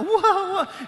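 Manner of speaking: high, loud laughing gasp of shock; mock shock, laughing; startled whoa. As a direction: play the women's stunned surprise. Ladies shocked by risen Jesus.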